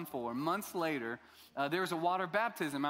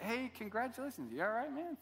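higher, softer, lightly voiced well-wishers; mock concerned congratulations, pitched up; churchgoers congratulating; 7 semitones higher, 4 dB quieter than usual. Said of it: "People were coming up to me like".

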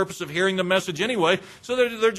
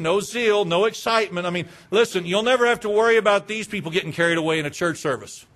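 ordinary talk, nothing marked

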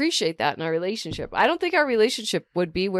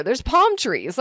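usual speaking voice

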